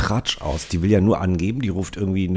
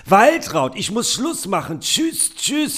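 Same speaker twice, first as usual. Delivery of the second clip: very high, gushing mother voice; shrill-free but sky-high chatty mum showing off; proud mother on the phone; 14 semitones higher, much slower than usual. Acted. Podcaster reads the mother's lines bragging to Waltraud, in a very high put-on voice. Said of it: "Du bist Mutter".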